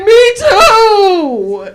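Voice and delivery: loud, high, drawn-out wail; mock crying. Mom agreeing she'd run away too, mock tearful wail. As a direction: wail it as a mother at breaking point, hammed up. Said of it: "And then we'd all cry".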